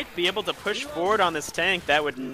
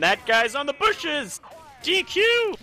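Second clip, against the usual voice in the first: mock outrage; 12 semitones higher, slower than usual. Spots player on bushes.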